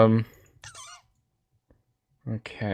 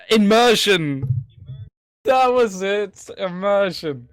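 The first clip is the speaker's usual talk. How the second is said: eureka moment; 7 semitones higher, slower than usual. Real, not acted. Remembering the forgotten word.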